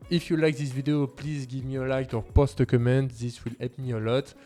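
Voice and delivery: normal talking voice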